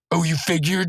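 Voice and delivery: high, scornful echo; berating. Acted. Muscle Man cutting Mordecai off, mock berating. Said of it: "berating him".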